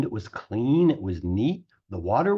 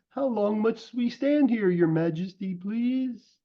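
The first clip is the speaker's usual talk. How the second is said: much higher, small pleading turtle voice; humble little turtle Mac; 8 semitones higher than usual. A narrator's reading. Mac begging King Yertle to let them rest, timid.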